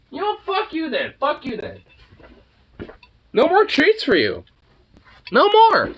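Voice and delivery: mock scolding. Real, not acted. Scolding the pet.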